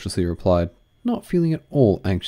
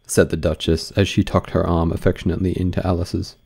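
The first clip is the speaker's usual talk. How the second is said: ordinary delivery, nothing marked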